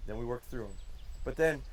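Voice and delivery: ordinary talk, nothing marked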